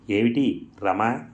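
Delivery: usual speaking voice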